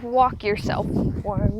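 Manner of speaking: drawn-out, dubious quoting back; skeptical echo. Retold or imitated. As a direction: echo the advice slowly, unconvinced. Incredulous echo of dad's advice.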